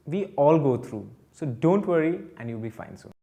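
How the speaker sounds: usual speaking voice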